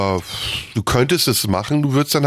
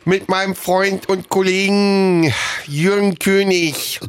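slushy lisp; 7 semitones higher, slower than usual. Pretend. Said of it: "als Berliner immer ein bisschen lispeln".

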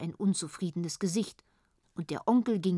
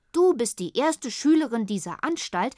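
mock-stern teacher, raised and higher; teacher's solemn verdict, louder; stern teacher quote, louder; 4 semitones higher, 6 dB louder than usual. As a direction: announce it like a grave teacher. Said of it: "berichtete Henriette".

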